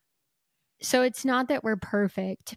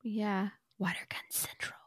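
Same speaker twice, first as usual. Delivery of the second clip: whispering; 11 dB quieter than usual. Real, not acted. Conspiratorial aside to burglars.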